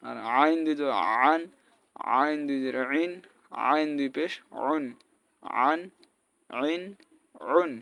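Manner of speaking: আইন, আন, উন squeezed from mid-throat; throaty ayn. Shown as a demonstration. Teacher contrasting with hamza, আইন pressed from the throat. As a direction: tight Arabic throat sound starting আইন and আন. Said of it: "আইনের উচ্চারণ".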